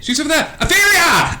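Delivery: high character voice rising to a call; rider calling out. Acted. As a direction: spot a friend and cry the name high. Terry spots and calls Etheria.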